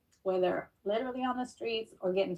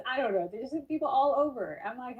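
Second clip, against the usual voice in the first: ordinary delivery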